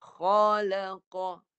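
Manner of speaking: "Khalaqa" stretched very slowly, kha from the top of the throat; modelling the word for the pupil; heavy kha demo. Shown as a demonstration. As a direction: press the kha at the start of the throat. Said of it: "Qaf dengan kha situ".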